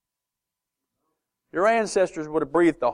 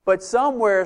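ordinary delivery

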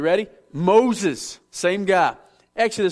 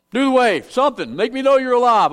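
louder, higher call to the crowd; rousing call-out; 5 semitones higher, 5 dB louder than usual. Real, not acted. Preacher urging the congregation to respond, loud rousing call. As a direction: wake the room up.

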